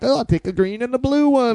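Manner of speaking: high, breezy party voice; airy and careless; carefree voice. Retold or imitated.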